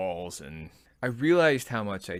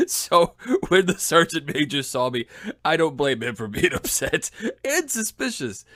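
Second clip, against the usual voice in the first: real laughter, raised pitch and volume; talking through laughter; 8 semitones higher, 7 dB louder than usual. Real, not acted. Speaker admits the sergeant major had reason, laughing through it. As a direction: keep talking while cracking up.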